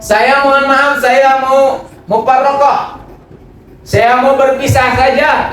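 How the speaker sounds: high, slow pleading wife voice; mock wife asking divorce. Preacher voicing a wife who wants to divorce her husband, high and pleading. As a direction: play the wife begging to leave.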